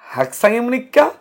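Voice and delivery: hak-saeng im-nik-ka, clear Korean; Korean question. Shown as a demonstration. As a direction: model হাকসাং ইমনিকা slowly for students. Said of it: "তাহলে".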